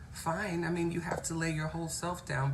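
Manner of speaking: ordinary talk, nothing marked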